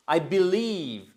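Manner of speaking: slow, deliberate "I believe"; slow model. Shown as a demonstration. Teacher offering a new expression, slow model. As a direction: say "I believe" stretched out for learners.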